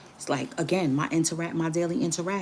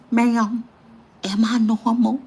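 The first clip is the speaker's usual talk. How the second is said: loud, eager imitation of the man asking; mock loud question; 5 semitones higher, 8 dB louder, slower than usual. Imitating the man in the store, loud and eager. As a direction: call out eagerly like the shy guy. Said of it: "he was there like this".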